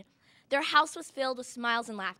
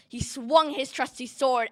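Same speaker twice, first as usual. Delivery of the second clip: ordinary talk, nothing marked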